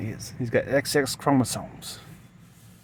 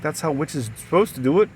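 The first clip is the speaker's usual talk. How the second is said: usual speaking voice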